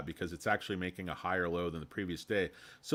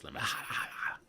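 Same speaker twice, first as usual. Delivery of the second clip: whisper; 4 semitones lower, 5 dB quieter, much faster than usual. Acted. Calling out to the chat.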